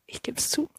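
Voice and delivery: soft embarrassed whisper; whispered confession. Real, not acted. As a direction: whisper it like a secret. Embarrassed confession about Peter.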